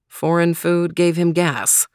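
ordinary delivery

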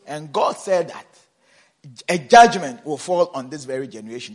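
full-force preaching. Acted. Quoting scripture at full volume.

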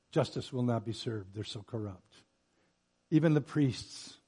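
usual speaking voice

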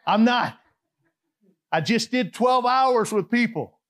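ordinary delivery

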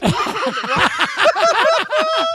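a burst of high, loud laughter; high squealing laugh. Real, not acted. Big laugh after the quote.

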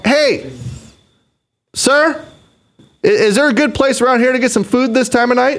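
sweetly innocent, messing with the guy; mock polite. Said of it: "I'm all".

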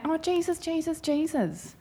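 high, mock-pious "Jesus"; mock preachy. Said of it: "preachy, preachy, preachy".

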